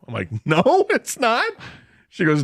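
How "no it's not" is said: high, drawn-out disbelief; incredulous echo. Retold or imitated. Guest learning breakfast is not free, incredulous. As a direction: stretch it upward as if astonished.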